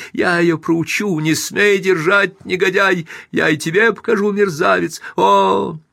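high-pitched frenzied yelling; furious screaming. A narrator's reading. Wife struggles in husband's grip, screaming in frenzy. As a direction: rage at him while fighting to break free. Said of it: "продолжала вырываться и кричать".

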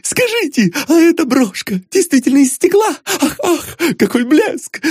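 very high, excitable falsetto; high, breathless admiration with little "ah"s; gushing flatterer. A narrator's reading. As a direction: heap on compliments in a squeaky, swooning voice. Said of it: "начал вызывающе острить".

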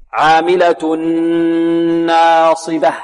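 "عاملة ناصبة" with a long nasal hum on the n; full ghunna. Shown as a demonstration. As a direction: merge "عاملة" into "ناصبة" through a held nasal n. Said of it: "وهذه الغنة أكمل".

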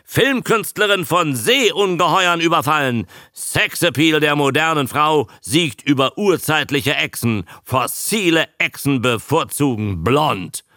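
high-pitched proclamation; crying headlines as Abe. A narrator's reading. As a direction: declaim each one like breaking news. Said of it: "schrie Abe".

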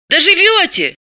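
high, quick exclamation; high-voiced breezy cry; exclaiming. A narrator's reading. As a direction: call it out brightly, pitched high. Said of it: "Далила воскликнула".

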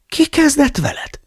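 indignant. Acted. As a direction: demand it in scandalized disbelief. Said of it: "méltatlankodott". (méltatlankodva)

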